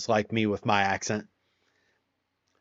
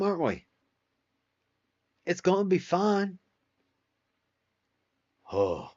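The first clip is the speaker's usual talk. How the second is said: Southern accent; 3 semitones higher than usual. Shown as a demonstration. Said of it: "would you like a demonstration of when I talk Southern?".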